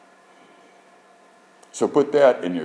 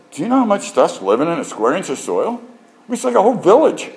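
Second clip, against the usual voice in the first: amazed; 9 semitones higher, 3 dB louder than usual. Retold or imitated. Amazed by life in soil.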